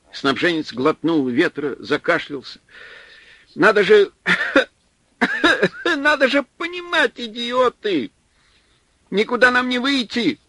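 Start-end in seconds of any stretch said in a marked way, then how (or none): 3.5-10.4 much higher, frantic cry broken by coughing; hysterical cry. A narrator's reading. Supply man screaming at the others, hysterical. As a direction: shriek in despair between coughs.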